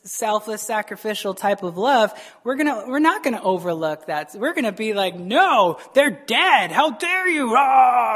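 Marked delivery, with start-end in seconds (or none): 5.1-8.1 hammed-up indignation building to a loud roar; mock outrage. Unloving believer refusing to overlook a wrong, outraged. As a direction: escalate into a comic furious yell.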